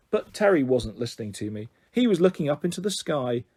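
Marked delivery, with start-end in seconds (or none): none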